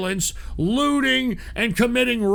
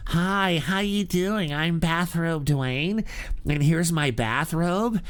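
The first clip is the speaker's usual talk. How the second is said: mock influencer voice; 3 semitones lower than usual. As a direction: sneer through a limp influencer impression. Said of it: "none of this".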